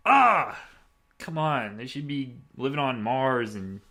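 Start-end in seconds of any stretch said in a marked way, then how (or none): 0.1-0.6 exasperated yell. Real, not acted. Outraged at future cars.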